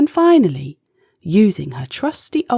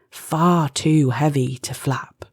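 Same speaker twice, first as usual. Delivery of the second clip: ordinary delivery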